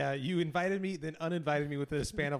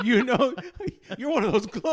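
lightly voiced suspicious cop, higher and louder, laughing; mock cop voice; 10 semitones higher, 11 dB louder than usual. Mocking the cop who asked for gloves, louder and higher. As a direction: play the cop accusing him, half laughing.

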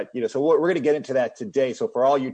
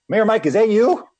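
excited greeting; 9 semitones higher, 6 dB louder than usual. Acted. Greeting the guest at the door.

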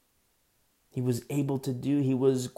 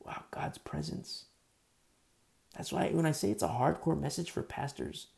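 ordinary talk, nothing marked

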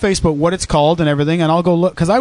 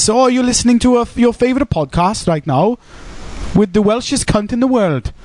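mock Welsh accent; 4 semitones higher than usual. Carries on the Welsh accent bit.

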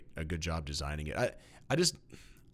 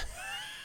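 soft laugh; 8 dB quieter than usual. Real, not acted. Laughs after his bit.

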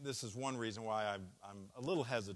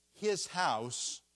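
"his" pushed up high and loud; "his house" with the weight on "his"; stress on his; 6 semitones higher, 8 dB louder than usual. Shown as a demonstration. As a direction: lean hard on "his". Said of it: "He talks about his house".

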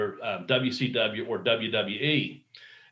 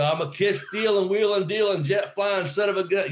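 higher, brash wrestling promo cadence; Ric Flair promo; 7 semitones higher than usual. Retold or imitated. Imitating Ric Flair in the bathroom, high promo. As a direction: strut through it like Flair cutting a promo. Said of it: "started cutting a couple Ric Flair promos".

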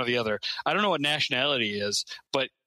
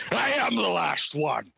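Sean Connery; 5 semitones higher than usual. Retold or imitated. A dragon declaring himself the last, grand Scottish impression. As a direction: proclaim it slowly in a Scottish accent. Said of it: "My favorite Sean Connery line is".

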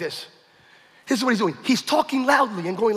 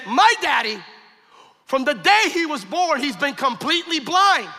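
higher, slowing, emphatic delivery; impassioned retold revelation; 6 semitones higher than usual. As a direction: imitate a son's urgent disclosure. Son's urgent revelation, retold.

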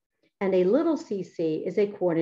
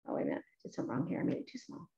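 whispered to herself while fiddling with the screen; breathy off-mic mutter over a mistake; muttered aside; 9 semitones lower, 12 dB quieter, much faster than usual. Real, not acted. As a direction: drop to a near-whisper, half to yourself. Aside while fixing the screen zoom.

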